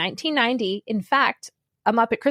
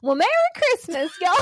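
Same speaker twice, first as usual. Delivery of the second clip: put-on a Kermit-ish Southern accent, higher; 7 semitones higher than usual. Greeting podcast listeners in a put-on high Southern accent. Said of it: "can you do a Southern accent".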